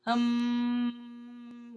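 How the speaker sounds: steady voiced hum; a sustained hum; droning hum. A narrator's reading. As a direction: hum like wings.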